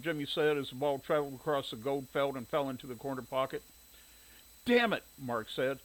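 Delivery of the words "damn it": annoyed curse. Acted. Missed shot at pool.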